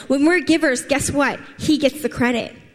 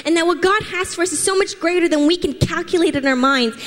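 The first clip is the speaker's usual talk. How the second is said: higher, lifted preaching voice building excitement; rousing preaching; 6 semitones higher than usual. Acted. Pastor urging the church to rise up, higher fervent preaching. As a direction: rise up and stir the congregation.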